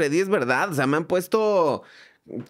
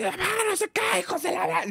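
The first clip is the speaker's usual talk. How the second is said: high put-on thug voice acting the robber; mock robber threat; 14 semitones higher, 3 dB quieter than usual. Narrator mimics the robber threatening him in a high put-on voice.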